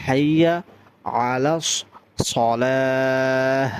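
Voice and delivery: সলাহ heavy-s and drawn long after হাইয়া আলাস; deliberate recitation with a full rounded সলাহ; thick sad. Shown as a demonstration. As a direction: say হাইয়া আলাস in pieces, then stretch সলাহ. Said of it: "সদটা মোটা করে".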